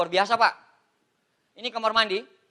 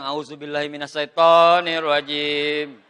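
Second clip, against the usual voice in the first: slow, drawn-out, louder recitation; stretched-out mock warding-off prayer; 7 semitones lower, 6 dB louder, slower than usual. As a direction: chant it in comic alarm at the wife. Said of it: "Kalau istri lagi datang".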